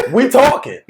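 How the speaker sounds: laughing through it; mocking laugh; laughing taunt. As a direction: chuckle the words out. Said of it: "just going like".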